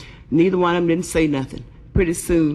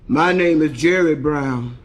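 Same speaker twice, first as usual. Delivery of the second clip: heavy voice; 3 dB louder, slower than usual. Acted. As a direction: drop the pitch and drag it out. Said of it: "How come you talk so heavy?".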